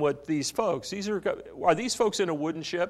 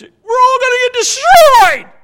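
screamed in terror, much higher and louder; panicked yell; 18 dB louder than usual. Shown as a demonstration. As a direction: shout it like doom is coming. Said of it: "I am sure it wasn't said in that tone".

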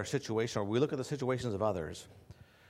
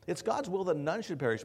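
ordinary talk

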